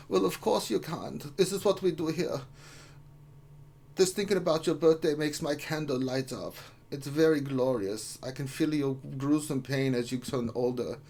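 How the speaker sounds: hammed-up German birthday wishes; German accent. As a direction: put on a comic German accent. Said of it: "in your German accent".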